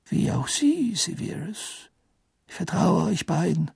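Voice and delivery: lightly voiced kind old headmaster; Dumbledore voice. A narrator's reading.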